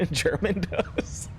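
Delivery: amused, cracking up mid-word; laughing. Real, not acted. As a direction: laugh through an embarrassing admission. Embarrassed joke, laughing.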